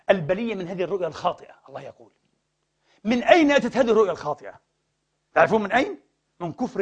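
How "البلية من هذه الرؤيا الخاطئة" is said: normal talking voice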